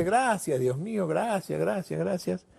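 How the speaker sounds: high, gushing thank-you; a lost man's high, relieved thanks; effusive thanks. Acted. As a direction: overflow with gratitude to God. Sailor thanking God effusively.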